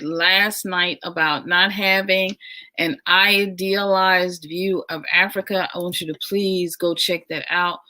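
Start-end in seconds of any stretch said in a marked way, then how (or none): none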